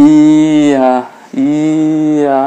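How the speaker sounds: ইয়া held long with a falling glide; diphthong demo. Shown as a demonstration. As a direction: model the sound ইয়া for students. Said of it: "যখন সাউন্ডটা করবেন ইয়া". (ইয়া ধ্বনি টেনে দেখানো)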